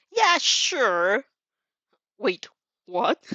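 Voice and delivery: surprised double take; incredulous. Real, not acted. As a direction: react in disbelief. Listener reacts out of story.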